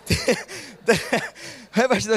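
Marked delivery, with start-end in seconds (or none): none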